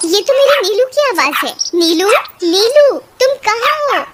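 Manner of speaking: excited calling for a lost pet; startled. Acted. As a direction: call out in surprised hope. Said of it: "वे दोनों चौंक जाते हैं".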